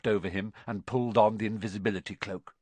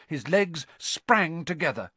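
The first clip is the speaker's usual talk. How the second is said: usual speaking voice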